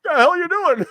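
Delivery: high, startled, laughing shout; startled yell. Retold or imitated. Caught in the dark locker room, a laughing startled yell. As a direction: yelp it like you just got caught.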